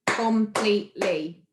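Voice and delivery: slow "completely" in three beats, com-plete-ly; syllable by syllable. Shown as a demonstration. Teacher listing quiz choices, "completely" slowly segmented. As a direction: sound out each chunk of "completely". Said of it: "pronouncing the words clearly".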